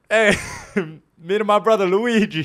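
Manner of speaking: high, loud, hammy Italian Mario voice; Italian accent. Acted. Storyteller voicing Mario greeting him abroad, loud hammy Italian accent. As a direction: belt it out like a cartoon Italian plumber. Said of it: "I'm gonna stop doing the accent".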